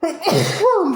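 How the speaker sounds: a sudden loud cough; coughing fit. Real, not acted. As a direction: cough hard. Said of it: "Pardon, je suis vraiment désolée".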